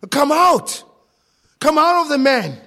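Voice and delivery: loud, high, commanding shout. Acted. Christ ordering demons out of the man, shouted command. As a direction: order it out at full voice. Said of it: "the command of Christ has gone forth".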